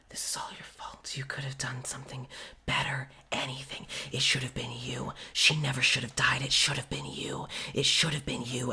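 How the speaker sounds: hushed, accusing whisper from the dark; haunting whispered voices. Acted. GM voicing the voices Ivor hears, an accusing whisper. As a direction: whisper the blame like a ghostly chorus.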